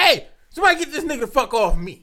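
a hammed-up plea to get her off; mock panic. Acting out panic at a woman.

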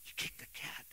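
whispered aside, unvoiced. Real, not acted. Pastor teasing about the cat, whispered. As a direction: breathe it out as a secret.

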